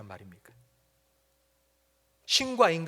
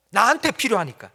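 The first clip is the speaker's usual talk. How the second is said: higher and louder, pressing on 나한테; louder insistence; 6 semitones higher, 11 dB louder than usual. Acted. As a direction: repeat it as a stubborn child's whine-shout. Jonah's answer repeated, louder.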